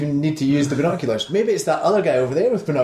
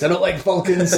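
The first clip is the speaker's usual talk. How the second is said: high laughing mock Cruise voice; mock Tom Cruise; 6 semitones higher than usual. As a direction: ham up a movie-star rant. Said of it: "Scientologist quote".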